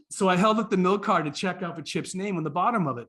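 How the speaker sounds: ordinary talk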